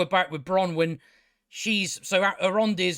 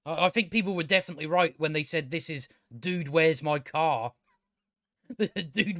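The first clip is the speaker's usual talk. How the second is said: ordinary talk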